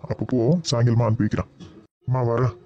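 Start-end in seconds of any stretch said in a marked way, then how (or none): none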